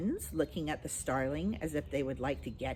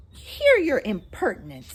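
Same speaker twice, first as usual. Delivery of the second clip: louder, cross scolding; 6 semitones higher, 10 dB louder than usual. A narrator's reading. Mary Poppins crossly scolds the starling, louder. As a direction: snap at the cheeky bird. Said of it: "said Mary Poppins crossly".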